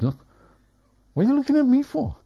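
very high, fast, squeaky indignant cat voice; cat's voice. Retold or imitated. The cat staring back at its owner, high squeaky indignant voice. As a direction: give the cat a high, quick, offended squeak.

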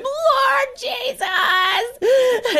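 high, very slow crying call; desperate cry. Retold or imitated. Overwhelmed mother crying out to the Lord, drawn-out wail. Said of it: "loudly yelling in the room".

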